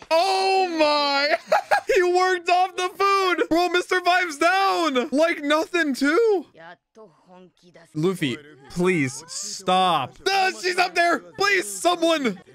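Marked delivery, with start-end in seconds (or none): 0.1-6.5 high, stretched-out squeals of excitement; thrilled amazement. Real, not acted. Amazed reaction to the knockout. 10.3-12.4 high-pitched panicky appeal; alarmed pleading. Real, not acted. Alarm for endangered character.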